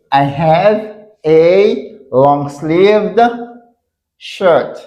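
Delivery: "I have a long sleeved shirt" spoken very slowly, each word separate; slow careful model sentence. Shown as a demonstration. Teacher modelling the correct sentence for students, slow and deliberate. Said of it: "Nòmalman, ou sipoze di".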